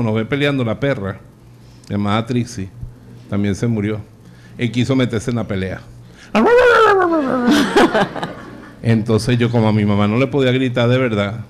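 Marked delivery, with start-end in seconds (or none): 6.3-8.5 shrieking high laugh imitating the yelp. The dog joins the quarrel, yelping high laugh.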